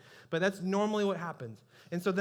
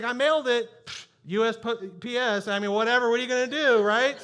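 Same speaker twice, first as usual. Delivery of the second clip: louder, laughing shrug-off; flippant; 5 semitones higher, 8 dB louder than usual. Retold or imitated. Pastor mocking his own tempted excuse, flippant and laughing. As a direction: toss it away like it's nothing. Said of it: "And I'm just like".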